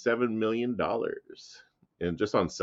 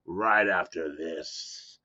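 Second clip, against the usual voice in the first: slow, loud, booming host voice; big dramatic intro, stretched out; hyped announcer intro; 5 semitones higher, 6 dB louder, slower than usual. Acted.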